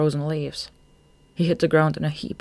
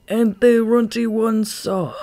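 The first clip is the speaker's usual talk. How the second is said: high, slow, loud admission; miserable confession; 7 semitones higher, 6 dB louder, slower than usual. A narrator's reading. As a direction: own up reluctantly to the boss.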